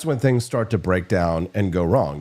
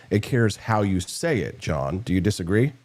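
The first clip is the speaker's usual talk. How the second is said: ordinary talk, nothing marked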